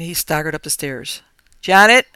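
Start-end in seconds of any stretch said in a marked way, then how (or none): 1.6-2.1 loud call rising high. Acted. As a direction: call up the stairs in alarm. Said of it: "he called, his voice unnatural".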